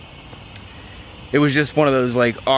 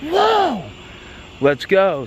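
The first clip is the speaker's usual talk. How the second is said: thrilled whoa; 7 semitones higher, much slower than usual. Retold or imitated. His thrilled reply.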